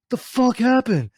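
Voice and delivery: high, loud, drawn-out yell; bewildered shout, re-enacted; retold yell. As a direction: yell it up to the friend on the road. Acted shouting up from pit.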